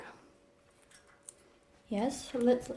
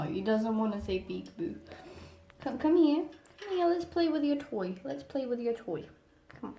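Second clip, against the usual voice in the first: ordinary talk, nothing marked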